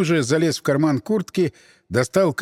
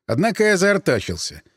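ordinary delivery

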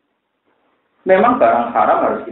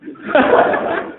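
breathy high-pitched laugh. Real, not acted. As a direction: burst into a light laugh. Laughs at his own joke.

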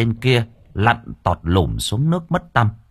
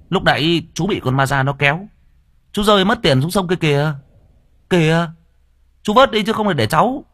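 sly nephew; 8 semitones higher than usual. Acted. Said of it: "nó thốt lên".

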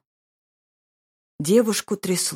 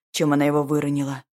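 usual speaking voice

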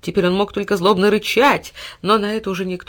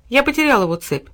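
vexed; 8 semitones higher, 4 dB louder than usual. A narrator's reading. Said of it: "с досады покачал головой Тильтиль".

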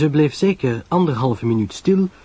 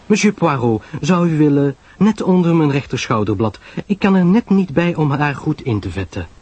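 higher-pitched woman's request; chatty Pamela, coaxing; 6 semitones higher than usual. Acted. Pamela asks Poirot to oil her back, coaxing. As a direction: ask a favour in a raised, lilting voice.

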